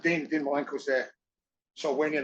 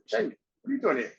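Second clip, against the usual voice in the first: put-on pitched-up surprise at an old friend; surprised greeting; 8 semitones higher than usual. Spotting old schoolmate Jamie at the show, higher and surprised. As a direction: call out, pleasantly shocked. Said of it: "I was like".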